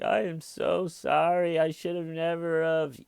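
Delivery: high-pitched, imitating a tearful on-air confession; mock tearful apology. Vanished bookseller apologizing on TV, mock tearful and high. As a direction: plead like a weepy detainee, a bit hammed-up. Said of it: "crying on T V".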